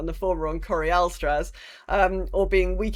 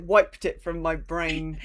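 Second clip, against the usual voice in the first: normal talking voice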